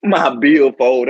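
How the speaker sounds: slow, laughing mockery. Real, not acted. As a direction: laugh it out slowly. Mocking old phrase laughing.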